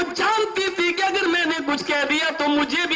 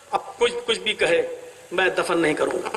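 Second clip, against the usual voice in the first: lower, firm promise; father's vow; 9 semitones lower than usual. Acted. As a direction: settle it as a solemn vow. Father's vow not to bury. (باپ کا پکا وعدہ، نیچی آواز)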